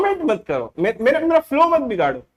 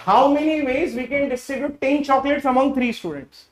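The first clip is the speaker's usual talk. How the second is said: each word of the question read out very slowly; slow dictation; much slower than usual. Acted. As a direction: dictate the problem so students can write it down. (धीरे-धीरे सवाल लिखवाना)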